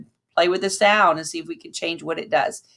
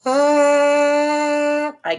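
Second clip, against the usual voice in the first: long, high, slow straight sound; straight steady tone; 9 semitones higher, 4 dB louder than usual. Shown as a demonstration. Teacher demonstrating a straight mouthpiece sound for students. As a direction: hold it perfectly straight and even. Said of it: "So that looks like this".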